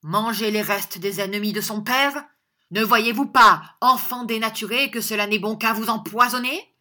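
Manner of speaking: outraged father crying out; indignant shouting. A narrator's reading. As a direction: berate the child at high volume. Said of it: "cria monsieur Squeers".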